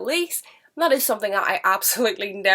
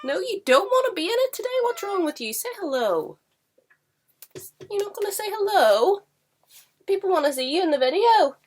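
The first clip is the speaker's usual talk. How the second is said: very high, cooing pet voice; pet talk; 12 semitones higher than usual. Real, not acted. Talking to the camera-shy cat.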